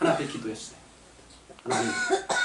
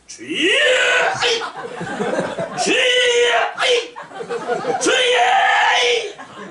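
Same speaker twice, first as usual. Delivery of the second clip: high, effortful shouts of strain, rising higher; straining cry to the Lord; much slower than usual. Retold or imitated. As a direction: grunt and cry out while heaving with all your might. Straining to pull tree root. (나무뿌리를 뽑으며 힘쓰는 높은 외침)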